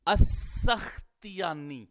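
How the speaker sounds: السختیانی said slowly, syllable by syllable; teacher's careful model of السختیانی; careful pronunciation. Shown as a demonstration. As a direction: spell out السختیانی slowly and clearly. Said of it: "لفظ ہے".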